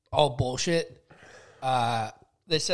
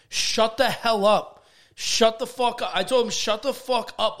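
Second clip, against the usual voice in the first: slow, higher and louder yell; angry shout; 5 semitones higher, 5 dB louder, slower than usual. Retold or imitated. Twelve-year-old telling off the teacher, a slow angry shout. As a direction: bite off each word like a kid who finally snaps.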